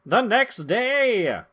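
very slow announcer caption; hammed-up narrator; dramatic narration. A narrator's reading. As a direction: draw it out like a serial narrator.

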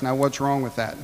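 ordinary talk, nothing marked

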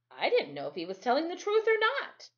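ordinary delivery

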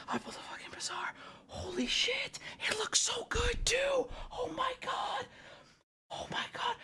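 high, airy excited whisper; hushed, squeaky disbelief; awestruck whisper. Real, not acted. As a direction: whisper it as if you can't believe your luck. Awestruck at the card pulled.

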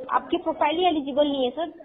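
normal talking voice

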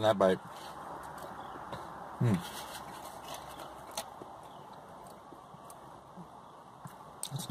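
speaking between chews; talking while chewing. Real, not acted. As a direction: mouth partly full of food. Seen 0: Speaks after bite; closed-lip chewing jaw motion from 0.8s onward.

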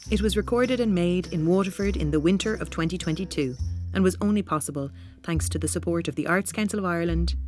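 normal talking voice

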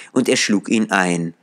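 ordinary delivery

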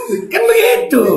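high, laughing squeal; helpless laughing voice; through laughter. Real, not acted. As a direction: squeeze the words out while cracking up. Talking through laughing fit.

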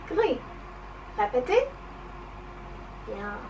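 ordinary talk, nothing marked

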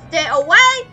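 shouting. Real, not acted. Warning during a fight.

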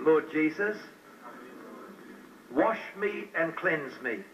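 slow, earnest prayer with long pauses; slow led prayer. Acted. Preacher leading new converts in prayer, slow and earnest. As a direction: lead the prayer phrase by phrase for others to repeat.

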